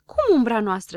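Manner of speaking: loud, surprised question; astonished. A narrator's reading. Habar n-am hears it is their shadow, astonished. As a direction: question it in disbelief. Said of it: "se miră Habar n-am".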